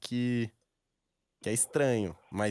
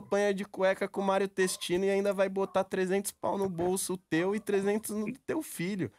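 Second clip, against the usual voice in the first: excited pleading; 8 semitones higher than usual. Real, not acted. Son pleading excitedly with father.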